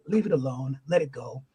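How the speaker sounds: low, restrained angel of conscience; shoulder angel. Retold or imitated. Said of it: "and the angel's going".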